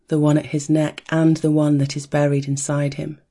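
plain reading voice

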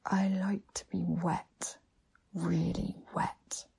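slow breathy whisper. A narrator's reading. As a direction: breathe it out slowly, intimately. Whispered request in erotic story.

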